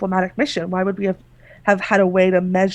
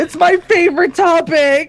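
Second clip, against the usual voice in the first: louder, higher through giggles; laughing; 7 semitones higher, 7 dB louder than usual. Real, not acted. GM joining the laughter. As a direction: laugh the line out.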